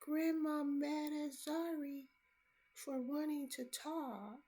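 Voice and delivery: put-on high, slow child's plea; lightly voiced little girl; child's words. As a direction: voice the granddaughter gently. Reading child's words aloud.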